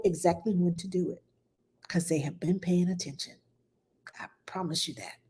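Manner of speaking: confiding whisper. Real, not acted. Parenting host whispering a promise to a worried mom. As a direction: lean in and whisper the secret. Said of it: "whispered aside to viewer".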